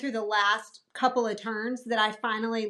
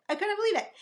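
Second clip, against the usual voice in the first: disbelief, pitched higher; 8 semitones higher, much faster than usual. Real, not acted. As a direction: say it again much higher, amazed.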